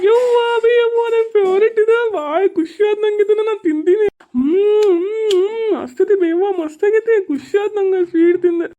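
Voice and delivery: high-pitched, choked-up thanks; delighted but breaking into tears; weepy happiness over a treat; tearful gratitude. Acted. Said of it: "ಮತ್ತ್ಯಾಕ್ ಅಳಾಗತ್ತಿ?".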